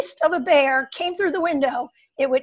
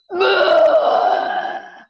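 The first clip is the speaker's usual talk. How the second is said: short loud guttural bear grunt; 5 semitones higher, 5 dB louder than usual. Retold or imitated. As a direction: growl it out like a big animal at the window. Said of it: "and it goes".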